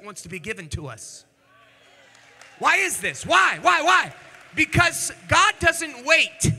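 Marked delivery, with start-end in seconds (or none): 2.6-6.5 higher and louder, shouting the points, slowing on the big lines; preaching at full force. Acted. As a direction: build it into a shouted sermon climax. Preaching climax.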